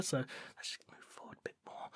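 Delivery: breathy whisper, barely voiced; whispered warning to keep moving. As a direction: hush it so the monsters can't hear. Creeping through monster-filled forest.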